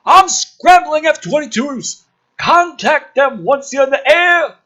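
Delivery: high, loud barked orders; hammed-up command. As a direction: shout it like a frantic general. Officer orders over chaos.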